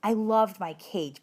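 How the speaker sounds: plain reading voice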